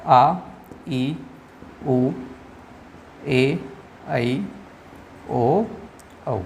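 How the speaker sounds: आ ई उ ए ऐ ओ औ each held long and said apart; long vowels demo. Shown as a demonstration. Teacher to students, each long vowel आ ई उ ए ऐ ओ औ drawn out. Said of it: "दीर्घ स्वर यहाँ पर कौन कौन से".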